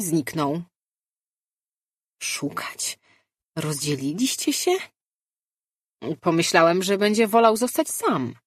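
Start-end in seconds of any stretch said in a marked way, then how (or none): 2.2-3.0 whisper. Acted. Inner voice in the mind.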